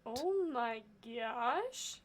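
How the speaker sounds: usual speaking voice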